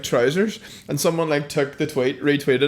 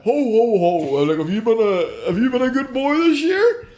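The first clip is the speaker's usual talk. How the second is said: hammed-up Santa; 6 semitones higher than usual. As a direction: ham up a shopping-centre Santa asking a child. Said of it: "people, like, ham it up".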